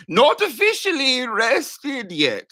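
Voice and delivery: high, slow mocking voice, every word stretched. Mocking a headline, in a high slow voice. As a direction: squeak it out like a silly cartoon voice. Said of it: "says perimenopause".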